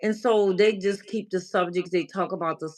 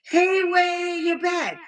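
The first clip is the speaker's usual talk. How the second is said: high, bright call out to a viewer; delighted welcome back shout; excited greeting; 10 semitones higher, slower than usual. Real, not acted.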